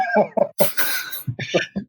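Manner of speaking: breathy high laugh burst; high giggle. Real, not acted. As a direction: crack up laughing. Breaks up laughing after sentence.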